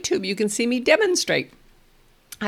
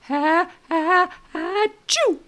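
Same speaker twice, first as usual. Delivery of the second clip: fake sneeze; 10 semitones higher, slower than usual. Shown as a demonstration. Therapist faking a big sneeze for a toddler, hammed-up. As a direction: ham up a sneeze for a baby. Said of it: "So I model one more time".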